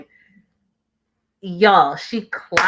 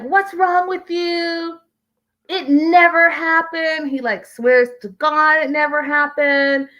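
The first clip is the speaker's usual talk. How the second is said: mildly hammed-up outrage, pitched up and slowed down; mock freak-out; 9 semitones higher than usual. Retelling a cheating denial on the show, mock freak-out. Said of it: "Yazan freaked out, y'all".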